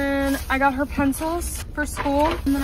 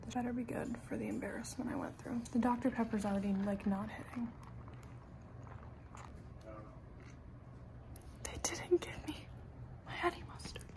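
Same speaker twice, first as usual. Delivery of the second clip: hushed, sulky whisper to herself; grumbling whisper in the car; upset whisper; 15 dB quieter than usual. Real, not acted. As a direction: whisper the letdown over the food. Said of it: "I know I sound dramatic".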